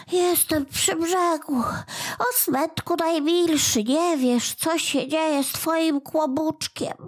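a small goblin moaning to himself; little creature lamenting. A narrator's reading. Kłobuk lamenting alone.

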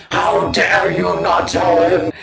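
hammed-up angry commenter; mock angry commenter shouting; mock outrage. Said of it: "It was like".

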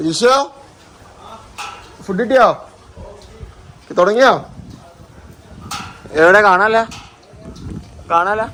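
calling out. Real, not acted. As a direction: shout across to her. Calling to Jilsha about food.

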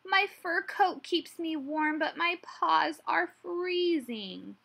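high, slow otter voice; plaintive otter. A narrator's reading. Otter telling Hedgehog of cold paws, plaintive. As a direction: complain in a high, drawn-out voice.

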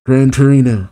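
ordinary delivery

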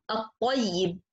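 at-tayyib with the lam merged into a doubled t; sun letter example. Shown as a demonstration. Teacher modelling at-tayyib for students, no lam. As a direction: say at-tayyib as att-tayyib, no l sound. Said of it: "Let's see examples".

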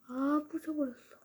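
ordinary delivery

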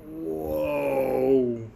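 ordinary delivery

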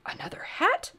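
very high, incredulous question; high-pitched disbelief; astonished. A narrator's reading. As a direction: squeak it in bewilderment. Boy astonished at second hat.

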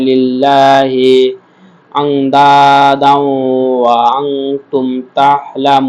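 very slow, drawn-out tilawat; Quran recitation. Shown as a demonstration. Teacher reciting the verse end for students, slowly chanted. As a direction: chant it, light on লিল্লাহি. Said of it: "আল্লাহ শব্দটাকে পাতলা করে পড়তে হয়।".

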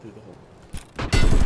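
loud, slow call across the map. Real, not acted. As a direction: shout it so they hear. Repeats louder after "Where?.

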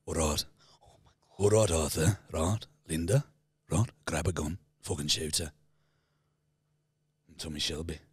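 low, quiet, menacing Tommy Shelby; Tommy Shelby impression. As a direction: mutter the orders in a cold Birmingham growl. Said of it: "Can you do Tommy again?".

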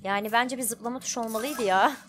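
ordinary talk

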